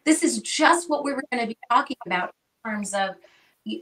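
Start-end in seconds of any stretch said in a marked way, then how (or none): 0.0-1.6 retold surprise. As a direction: stretch out a stunned reaction, retelling it. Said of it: "I was like".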